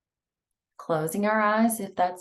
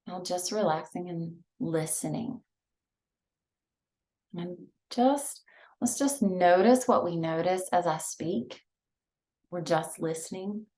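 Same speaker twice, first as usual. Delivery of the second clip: guided meditation; 3 dB quieter than usual. Acted. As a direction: ease them into each breath, hushed. Leads breathing meditation.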